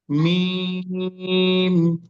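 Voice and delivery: মিম stretched very long on a steady note, closing in a hum; demonstrating Meem. Shown as a demonstration. Teacher asks learners to copy, long-held মিম. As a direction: draw out মিম slowly on one level tone. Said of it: "আমি যেভাবে করছি সেভাবে দেখুন".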